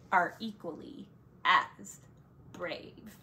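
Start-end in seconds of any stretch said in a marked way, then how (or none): none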